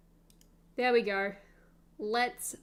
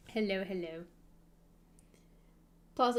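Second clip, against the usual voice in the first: normal talking voice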